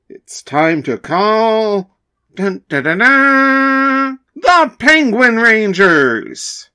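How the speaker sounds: slow, building announcer reveal; announcer hypes the heroes' arrival; dramatic announcement. Acted.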